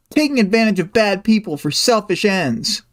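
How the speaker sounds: high-pitched acted accusation; mock accusing. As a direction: throw it back as an indignant quote. Acted quote of Amy's accusation.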